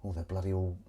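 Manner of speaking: usual speaking voice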